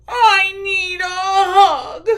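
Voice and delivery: high, slow, loud tearful plea; whiny crying plea. Acted. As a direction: wail it like a lonely cub. Bear cub pleads for a hug.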